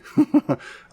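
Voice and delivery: short, high-pitched chuckle; self-amused little laugh; real chuckle. Real, not acted. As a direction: chuckle briefly at your own difficulty. Laughs at own trouble with toes.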